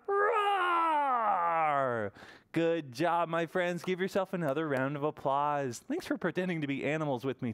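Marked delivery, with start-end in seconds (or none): 0.1-2.1 long, loud mock roar; hammed-up full lion roar; big pretend lion roar. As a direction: roar like a proud lion for the kids. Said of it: "give a roar".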